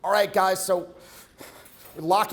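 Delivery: lightly imitated pinched, tense voice. Coach imitating the student, slightly pinched. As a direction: mimic tension in neck and throat. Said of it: "kinda like a pinched element to his voice".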